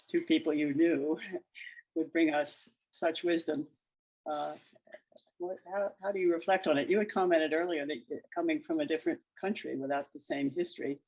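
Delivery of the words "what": ordinary talk, nothing marked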